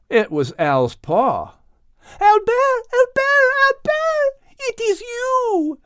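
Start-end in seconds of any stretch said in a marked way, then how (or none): 2.2-5.8 very high, loud joyful cry of a name; overjoyed discovery. Acted. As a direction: call his name in rising delight. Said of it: "Liz said as she saw his paw".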